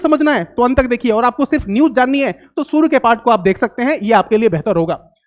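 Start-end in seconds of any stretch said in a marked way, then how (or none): none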